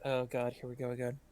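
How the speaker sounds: quick, soft exasperated mutter; muttering under breath. Acted. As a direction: grumble it quietly to yourself. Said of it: "kinda mutter under her breath".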